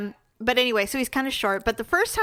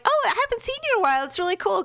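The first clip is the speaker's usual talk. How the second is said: bubbly greeting; 10 semitones higher, faster than usual. Retold or imitated. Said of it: "and I'm like".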